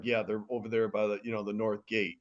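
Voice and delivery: a seasoned samurai who won't panic; calm veteran. Retold or imitated. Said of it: "just calm and collect".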